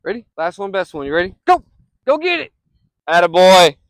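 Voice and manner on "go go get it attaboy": loud, higher cheering commands; calling the dog. Real, not acted. Owner sending his dog for the last throw, loud cheering commands. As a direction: hype him up.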